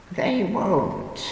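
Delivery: put-on high, soothing old woman reassurance. A narrator's reading. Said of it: "she reassured me soothingly".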